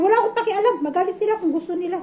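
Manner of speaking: high, loud defiant retort; defiant outburst. Retold or imitated. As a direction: toss it off like you truly don't care. Asis defiant about mother-in-law's anger. (palabang sagot, mataas at malakas)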